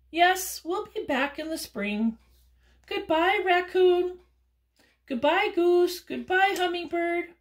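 high, loud farewell; high goodbye voice. Acted. Birds saying goodbye to raccoon, high and loud. Goodbyes before flying away.

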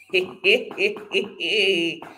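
long, giggly laugh; delighted laugh. Real, not acted. Speaker overjoyed by the statement she repeats, delighted laughing. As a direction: laugh freely at your own declaration. Overjoyed at her own declaration.

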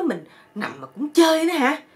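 high, loud imitation of a complaint; mock indignant yell. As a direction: mimic someone's exasperated shout. Wife mimics the friend's outburst.

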